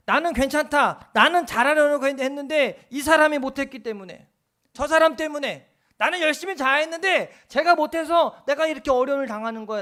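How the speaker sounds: loud, high whiny excuse-making; mock complaint. The preacher mocks a person blaming others, loud and high. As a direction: shout the blame away from yourself. Mimicking self-justifying complainers.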